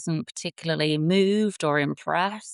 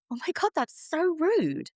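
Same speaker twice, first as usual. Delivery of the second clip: high, playful indignant squeal; mock outrage; 10 semitones higher than usual. Mock offended to a baby elephant who blew at her. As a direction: pretend to be offended, high and bright. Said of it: "and I just went".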